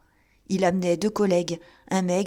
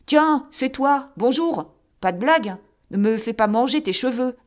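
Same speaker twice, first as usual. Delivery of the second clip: rising voice; 5 semitones higher, 5 dB louder than usual. Acted. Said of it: "Il haussait toujours la voix".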